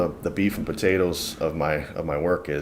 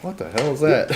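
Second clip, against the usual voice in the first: surprised; 7 semitones higher than usual. Retold or imitated. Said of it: "it's like".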